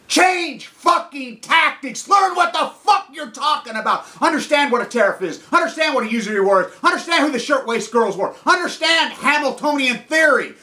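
furious high-pitched haranguing; angry rant. Real, not acted.

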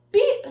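high loud little horn beep; cheerful beep. Acted. Little Blue honking to the stuck limo, high cheerful beep. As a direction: toot it brightly. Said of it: "said Blue".

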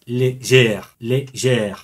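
légère slowly, in careful French; légère with closed first vowel, open second; careful French pronunciation. Shown as a demonstration. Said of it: "lo he pronunciado".

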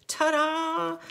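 high, loud ta-da; ta-da reveal. Real, not acted. Crafter reveals her card to viewers, bright and loud. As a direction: unveil it with a flourish.

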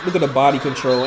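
usual speaking voice

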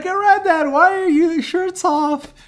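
piercingly high mock kid voice; annoying kid. Grandson asks granddad about his shirt, in an annoying high kid voice. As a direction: whine it way up high like an irritating child. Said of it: "in the annoyingest voice you could do".

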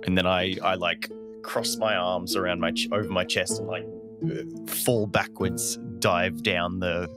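ordinary talk, nothing marked

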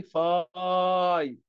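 فاي with the long i drawn out very slowly; slow modelling of the long vowel; stretched long i. Shown as a demonstration. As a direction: hold the i in فاي as long as you can. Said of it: "مد في الآي".

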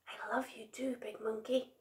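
very soft, breath-only whisper. A narrator's reading. Little Monkey on Big Monkey's back, whispering. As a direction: whisper it affectionately. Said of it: "he whispered".

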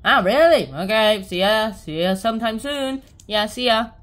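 loud acted farewell; calling goodbye. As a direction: holler a see-you to a friend. Kids call goodbye from outside.

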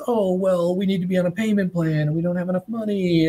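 high, put-on excuse-making customer voice; mock whiny customer. Founder mocking the customer who would not pay, high whiny excuse voice.

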